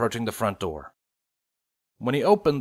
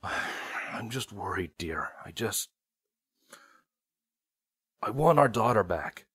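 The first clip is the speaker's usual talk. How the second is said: worried, quiet; 6 dB quieter than usual. Acted.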